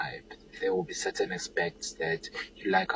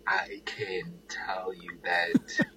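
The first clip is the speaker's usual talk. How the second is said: ordinary talk, nothing marked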